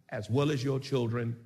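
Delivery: normal talking voice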